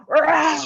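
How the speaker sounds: loud, high mock tiger roar; a big playful animal growl on stage. Shown as a demonstration. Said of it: "be like this tiger".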